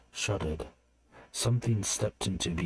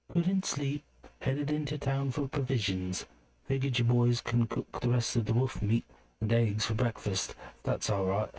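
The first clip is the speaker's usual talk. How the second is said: put-on higher, light girlish voice reading a note; note read in a girl's voice; 6 semitones higher than usual. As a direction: read the note as the young woman who wrote it. Note from Aaron and Alira read out.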